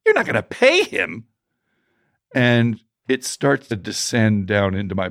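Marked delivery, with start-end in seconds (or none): 0.0-1.3 high, incredulous mock-wife voice; hammed-up high-pitched disbelief; protesting wife. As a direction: imitate an appalled spouse objecting. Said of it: "and my wife says".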